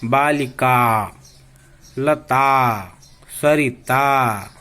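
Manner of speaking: each word spoken slowly; teacher pacing examples for children. Shown as a demonstration. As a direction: read the examples slowly with final आ clear. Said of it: "उदाहरण के लिए".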